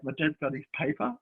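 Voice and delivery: ordinary delivery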